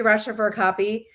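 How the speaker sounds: ordinary talk, nothing marked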